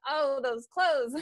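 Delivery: laughing. Real, not acted. Laughing at old photos.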